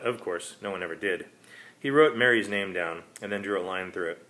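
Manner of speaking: ordinary delivery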